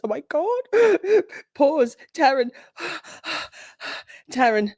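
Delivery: gasping, high and thrilled; excited surprise. Real, not acted. Streamer spotting Taryn in chat, thrilled gasp. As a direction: squeal at seeing Taryn in chat. Spots new mod, gasps.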